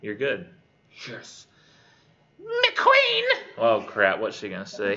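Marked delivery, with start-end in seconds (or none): 2.4-3.2 very high, loud screeched call; crass Cockney hag. Acted. Hag calls out before the queen, shrieking Cockney hag voice. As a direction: screech it out like an old crone. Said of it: "That's how she would talk".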